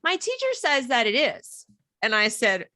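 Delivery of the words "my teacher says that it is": higher kid voice, lightly imitated; lightly voiced daughter, pitched up; daughter answering. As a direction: report it as a child trusting the teacher. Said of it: "she's like".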